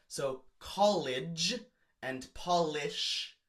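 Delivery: college and polish drawn out slowly; slow rhyme demo. Shown as a demonstration. Teacher slowly sounding out an imperfect rhyme. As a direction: stretch the "ah" in both words. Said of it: "imperfect rhyme because they both have the ah sound".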